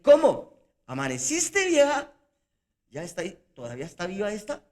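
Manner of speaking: hammed-up scorn at the old wife; mock disbelief. Shown as a demonstration. Old husband jeering at his aged wife, loud mock disbelief. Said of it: "ahí usé varios tipos de humor".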